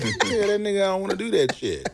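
laughing quote. Retold or imitated. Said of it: "He said".

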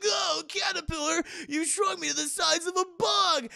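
high, tiny-person yell; tiny hero's protest. Retold or imitated. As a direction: cry out high as if shrunk. Shrunken hero yelling.

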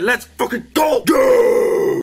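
high, loud battle cry; hype shout. Real, not acted. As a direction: scream it out to get hyped. Launching the big shot.

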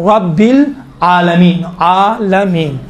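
रब्बिल thick, आलमीन stretched slowly; heavy ra, drawn out. Shown as a demonstration. Teacher drilling the heavy ra, slow. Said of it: "मोटा तलफ़ुज़ किया जाता है".

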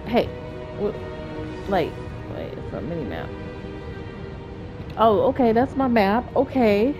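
usual speaking voice

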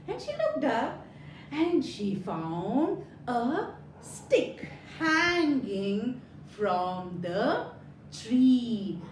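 plain reading voice

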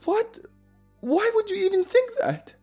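shocked. Acted. Shocked friend, near tears.

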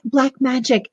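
ordinary delivery

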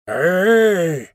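long, drawn-out creature call; a strange grunt of a word from a dark lump. A narrator's reading. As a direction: draw it out like an unseen beast. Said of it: "came out of the lump of mystery".

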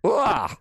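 short high croaked "ah"; failing-voice demo. Shown as a demonstration. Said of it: "that voice is like".

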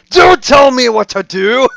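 high, loud furious outburst with a laugh; angry shout. Retold or imitated. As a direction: yell it back, laughing. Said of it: "get the Scottish accent".

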